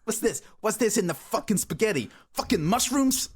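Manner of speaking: loud, high New York Italian mobster voice; hammed-up Sopranos tough guy; mock Italian gangster. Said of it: "That's not an Italian accent".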